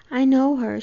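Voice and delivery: a sudden loud cry on know; cried out. A narrator's reading. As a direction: blurt it out, pleading and loud. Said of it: "she cried".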